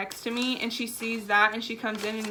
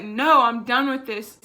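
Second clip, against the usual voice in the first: a sharp, louder no; angry retort; 2 semitones higher, 8 dB louder than usual. Retold or imitated. To the customer service coworker, a loud upset retort. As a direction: bite back at the worried coworker. Storming upstairs, coworker asks.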